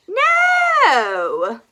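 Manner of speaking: high, loud commanding cry; teacher's shout. Acted. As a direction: bellow it at the class. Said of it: "said Madam Dragon".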